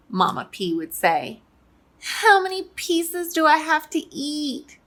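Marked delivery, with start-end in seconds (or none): 2.0-4.8 put-on high-pitched little kid's question; reluctant child. Little pea asking parents about candy, high reluctant kid voice. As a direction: ask it high, like a kid facing dinner.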